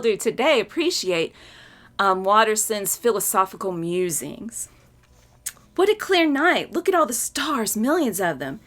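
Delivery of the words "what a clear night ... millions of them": Hobbes stargazing. Acted. Hobbes' line in strip.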